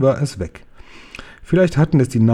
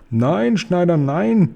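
high, imploring wail; hammed-up desperate plea, pitched high; pleading cry; 6 semitones higher, slower than usual. A narrator's reading. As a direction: beg for mercy as a frightened giant. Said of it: "schrie er flehentlich".

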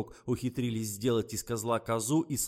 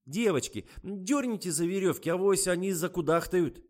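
high, loud call; shouting to the girls; 9 semitones higher, 6 dB louder than usual. A narrator's reading. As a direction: call out instructions across the studio. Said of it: "закричала Лиза".

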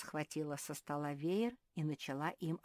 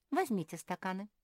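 ordinary delivery, nothing marked

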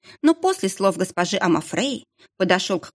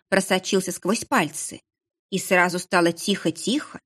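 ordinary delivery

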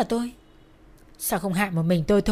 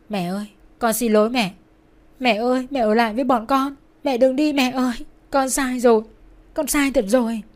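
tearful cry, raised and louder; sobbing plea; 4 semitones higher, 4 dB louder than usual. A narrator's reading. Daughter at her dying mother's bedside, sobbing plea. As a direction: beg forgiveness through acted tears. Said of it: "cúi đầu khóc nức nở".

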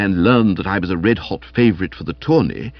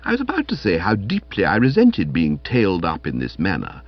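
ordinary delivery

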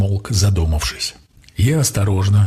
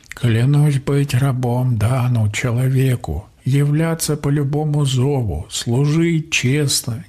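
slow, higher-pitched ceremonial recital; solemn oath; 5 semitones higher, slower than usual. A narrator's reading. Water creature swears to serve his master, solemn oath. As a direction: intone a binding vow, drawn out.

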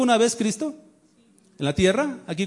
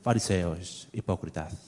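much lower, much softer, syrupy rebuke; mock soft movie Christ; 13 semitones lower, 8 dB quieter than usual. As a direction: parody a limp, sugary scolding of the Pharisees. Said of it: "hasta cuando habla con los fariseos".

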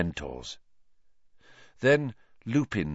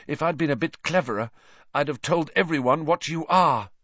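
ordinary delivery

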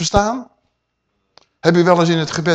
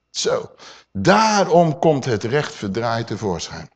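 ordinary talk

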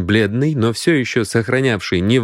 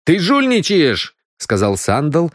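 put-on accusing young man; 10 semitones higher than usual. Сандал accuses his card partner of cheating, indignant.

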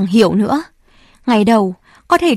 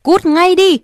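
much higher, louder yell; 8 semitones higher, 4 dB louder than usual. Acted. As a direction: bellow them away. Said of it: "bác hét toáng lên". (hét toáng lên)

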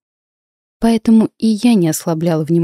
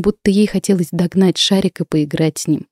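plain reading voice

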